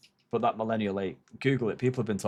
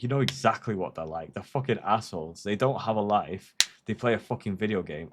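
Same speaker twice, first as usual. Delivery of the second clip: ordinary talk, nothing marked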